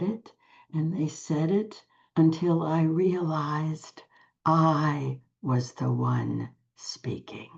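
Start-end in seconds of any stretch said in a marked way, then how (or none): 4.4-7.5 slow whispered realization; hushed awe, slow. Real, not acted. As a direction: drop to a slow whisper as it dawns. Revelation told in hushed tone.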